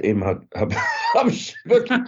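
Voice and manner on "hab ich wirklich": high voice breaking into laughter; words squeezed out through a big laugh. Real, not acted. Laughing as he tells punchline.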